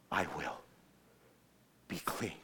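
very low, hushed words of healing; tender near-whisper. Acted. Jesus answering the leper, almost whispering. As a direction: murmur it gently, with a pause.

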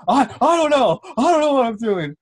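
high, loud flustered freshman; mock panic. Freshman answering the coach's challenge, mock panicked wail. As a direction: blurt it high and helpless, imitating him.

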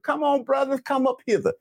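high-pitched preacher's summons; calling out. Real, not acted. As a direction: call the congregation forward, pitched high. Preacher calls men up front.

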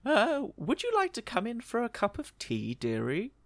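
put-on high, soft sweet old lady offering tea. Margaret invites John in for tea, sweet old-lady voice. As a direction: lift into a gentle granny voice. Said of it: "and she says".

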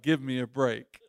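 low, dismissive mock grumble; acted weary dismissal; unimpressed pessimist. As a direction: drop the pitch like an unconvinced cynic. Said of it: "all the pessimistic people are like".

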